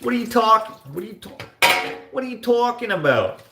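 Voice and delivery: high-pitched attempted New Yorker accent. Imitating the moderator's talking voice, New Yorker accent. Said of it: "sounds like a New Yorker".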